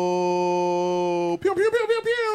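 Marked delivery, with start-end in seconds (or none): 1.4-2.3 high pitched pew pew blasts; vocal air horn sound effect; air horn noise. Retold or imitated. Mimicking the air horn again.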